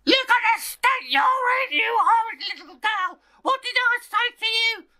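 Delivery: very high, loud furious screech; a mother shrieking at her filthy child; screeching angry mother. Acted. Mother screeches at daughter covered in mud.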